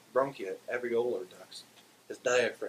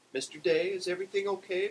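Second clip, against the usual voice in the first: high-pitched, louder worried question; concerned neighbor voice; 10 semitones higher, 4 dB louder than usual. A narrator's reading. Beulah finding Barney choking, high concerned voice. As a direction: ask after him in a high voice.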